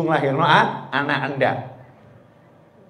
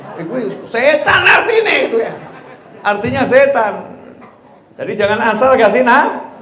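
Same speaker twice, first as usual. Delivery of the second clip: loud, high sermon voice with laughter; fiery preaching; 8 semitones higher, 8 dB louder than usual. Acted. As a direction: drive the point home to the crowd. Preaching at full force, laughing.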